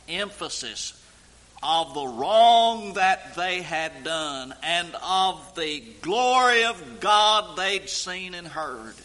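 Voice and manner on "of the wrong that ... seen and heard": louder, slower building sermon voice; rising preaching. Acted. Preacher to congregation about God's glory, swelling preaching.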